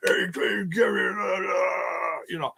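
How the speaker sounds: wordless shouted wrestler growl; mock wrestler promo, straining cry; Baron von Raschke. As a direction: ham up a crazed wrestler's noises. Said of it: "and Baron von Raschke".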